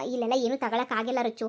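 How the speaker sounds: usual speaking voice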